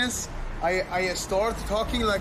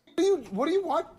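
shocked; 8 semitones higher than usual. Real, not acted. Shocked reaction to Faker losing.

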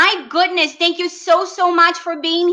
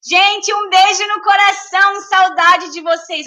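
raised, higher-pitched affectionate call-out; real excited shouting; 4 semitones higher, 4 dB louder than usual. Real, not acted. Streamer greeting her watching family, carried away into near-shouting. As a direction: send love loudly to everyone. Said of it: "Eu tô gritando".

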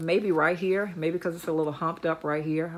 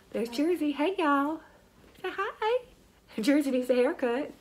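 pet voice; 9 semitones higher, 3 dB quieter than usual. Real, not acted. Talking to the dog Jersey.

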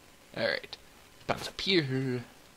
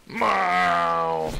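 long, higher-pitched falling meow; pretend cat yowl; 4 semitones higher, 4 dB louder than usual. Player as a mischievous cat, drawn-out yowl.

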